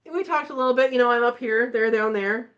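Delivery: ordinary talk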